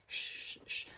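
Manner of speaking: unvoiced soft shushing; calm the cat down with shushes; hushing. Real, not acted. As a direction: owner hushing a wriggling cat.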